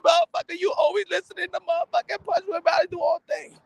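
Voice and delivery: high, shouting wife tirade; furious wife. Retold or imitated. Wife yelling at her husband over boxing videos, furious shout. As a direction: scream at him for choosing boxing.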